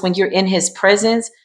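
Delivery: ordinary delivery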